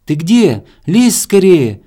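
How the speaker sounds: high, loud impatient call; squirrel shouting. A narrator's reading. Squirrel calling to hare out of the darkness, urgent shout. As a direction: yell for them to hurry up. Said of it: "кричала белка из темноты".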